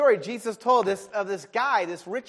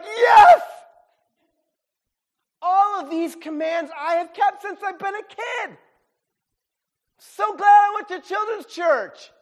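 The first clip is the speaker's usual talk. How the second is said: high, loud, thrilled boast; overjoyed yelling of a proud young man; excited shout; 13 semitones higher, 11 dB louder, slower than usual. Acted. Rich young ruler's excited boast.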